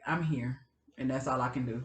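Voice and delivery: low, quiet bad-day answer; resigned. Woman giving her bad-day answer, quietly resigned.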